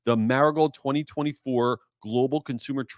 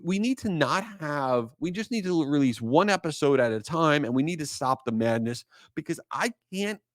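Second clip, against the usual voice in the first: ordinary delivery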